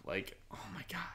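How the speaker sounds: whispered shock, breathy and hushed. Real, not acted. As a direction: drop to a whisper, scandalized. Shocked aside about her insults.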